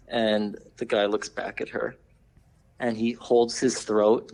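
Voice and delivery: usual speaking voice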